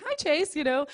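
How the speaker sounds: high, loud, cheery mock surprise; retelling her own overexcited hello to a child; bright surprised greeting. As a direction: greet a kid with big playful interest. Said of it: "I'm like".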